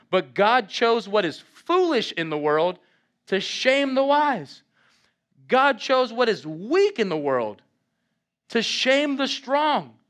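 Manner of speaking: slow, ringing preacher's delivery; preaching a verse with rising force; emphatic scripture reading. Real, not acted. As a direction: declaim each phrase, drawing out the key words.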